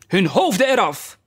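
high-pitched royal death sentence; imperious outburst. A narrator's reading. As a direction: pronounce it crossly, pitch shooting up. Enraged queen orders an execution. (bevelende uitroep van de boze koningin)

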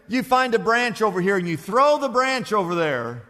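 ordinary talk

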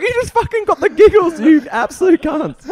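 mock-yell it at the cops while cracking up; laughing outburst. Telling his friends about the giggling cops, laughing hard. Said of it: "I'm like".

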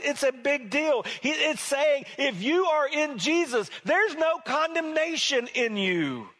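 rising fervent preaching. Acted. Preacher rising to full force.

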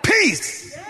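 high, falling cry of command; sharp, high order; commanding take. Shown as a demonstration. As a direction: call it out with authority. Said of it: "I think he said".

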